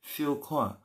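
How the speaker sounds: "kwa" with a breath of H after the k; careful "Siu kwa", puff of air on "kwa"; audible H. Shown as a demonstration. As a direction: put an aspirated H into "kwa". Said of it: "Pasti ada huruf H-nya ya".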